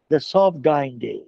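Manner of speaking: lingering, savoring each word; slow poetic recital. Acted. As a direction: read it like a poem to the class. (ধীরে কবিতার মতো আবৃত্তি)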